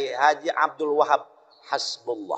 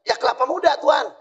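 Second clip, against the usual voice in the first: eager villager; 10 semitones higher than usual. Retold or imitated. Villager explaining to Dutchman.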